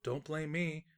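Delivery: ordinary delivery, nothing marked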